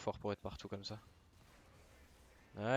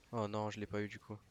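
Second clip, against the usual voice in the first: ordinary delivery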